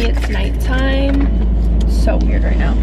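loud, slow exclaim; dramatic. Real, not acted. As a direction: stretch it out dramatically. Dramatic gym-at-dark reaction.

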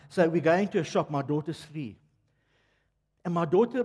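normal talking voice

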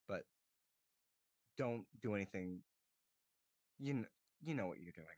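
normal talking voice

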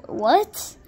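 ordinary delivery

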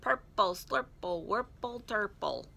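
playful, bouncing rhyme; singsong nonsense words, mildly giddy; giggly wordplay. A narrator's reading. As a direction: toy with each silly word. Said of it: "and he giggled".

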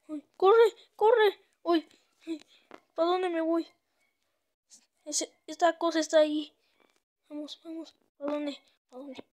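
high, loud frantic shouts; panicked yelling. Real, not acted. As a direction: yell while fleeing in panic. Fleeing the monster again. (gritos de pánico)

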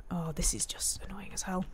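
annoyed whisper under the breath; whispered grumble; frustrated whisper. Real, not acted. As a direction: hiss the frustration quietly to yourself. Frustrated aside to self.

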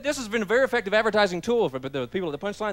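ordinary talk, nothing marked

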